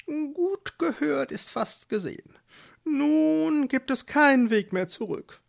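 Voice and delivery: high, squeaky mole saying his motto; wise mole. Acted. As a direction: deliver it like a little know-it-all mole. The mole comments.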